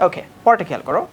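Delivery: ordinary delivery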